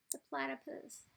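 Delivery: ordinary delivery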